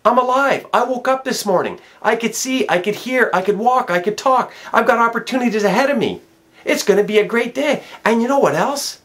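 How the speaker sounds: high, loud, fervent declaration; rally him to seize the day; passionate speech. Retold or imitated. Standing up at breakfast to inspire the stranger, fervent. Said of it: "I had to stand up and say".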